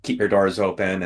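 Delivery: usual speaking voice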